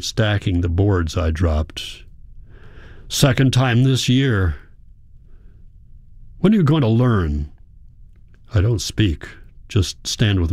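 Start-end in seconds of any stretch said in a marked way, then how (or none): 3.1-7.5 higher-pitched, exasperated reproach; angry scolding. A narrator's reading. Father to his silent son before the others, angry scolding. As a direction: scold with restrained anger.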